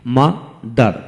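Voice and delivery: "Mother" slowly as মা, দার; split into syllables. Shown as a demonstration. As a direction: pause between মা and দার in "mother". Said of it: "দুটি syllable থাকে ডাইসিলেবল বলা হয়".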